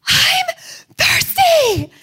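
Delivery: put-on high, loud child's wail from the back; little boy cry. Son in the third row, high loud child wail. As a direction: call out like a parched toddler. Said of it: "I will hear".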